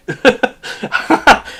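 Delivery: high chuckling laugh; short breathy bursts of laughter. Real, not acted. Laughing at a comment.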